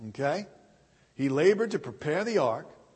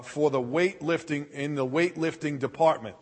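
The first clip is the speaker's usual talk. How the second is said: normal talking voice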